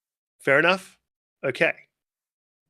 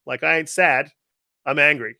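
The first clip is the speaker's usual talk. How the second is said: ordinary delivery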